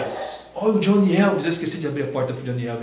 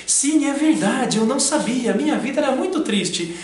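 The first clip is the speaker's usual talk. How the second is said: testimonial voice; 9 semitones higher, 3 dB louder than usual. Pretend. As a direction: play the sad satisfied customer. Depoimento no anúncio. (depoimento de cliente de comercial)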